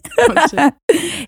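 high amused laugh. Real, not acted. Both laugh about the accent.

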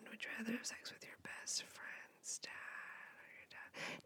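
a whispered, secretive version of the question. Shown as a demonstration. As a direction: hush it like a secret.

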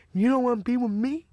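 imitating a rejected man's hurt question; mild mock disbelief. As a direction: lightly act out wounded surprise. Acting out rejected Roscoe's first reaction.

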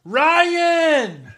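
high yell across the house; Drake yelling from another room. Pretend. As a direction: call his name from the next room. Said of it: "I just wanna hear Drake be like".

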